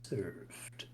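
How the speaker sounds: slow, whispered, hollow butler voice; hollow ghost voice. A narrator's reading. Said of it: "He speaks in a hollow voice".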